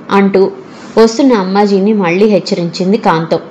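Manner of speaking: plain reading voice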